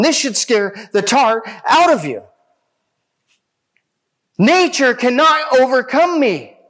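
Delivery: rising, fervent preacher's warning; preaching at full force. Real, not acted. Preaching climax on Jesus' power.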